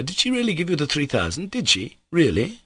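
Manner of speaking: high, doubting questions; dubious. Acted. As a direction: ask it in disbelief. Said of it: "eyeing him dubiously".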